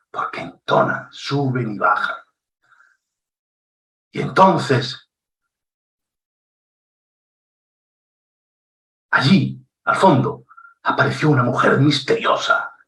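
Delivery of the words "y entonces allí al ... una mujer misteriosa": suspenseful fairy-tale telling with long pauses; storyteller intonation. Shown as a demonstration. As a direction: tell it like a mysterious bedtime story, drawing them in. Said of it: "Esa es la entonación".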